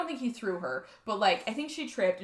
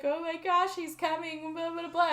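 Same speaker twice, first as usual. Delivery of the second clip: panicked daughter; 6 semitones higher than usual. Retold or imitated. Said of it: "She's like".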